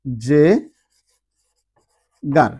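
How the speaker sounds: জে, pause, গার, said as jegar; syllabified demo. Shown as a demonstration. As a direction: break জেগার into জে and গার. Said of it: "double L".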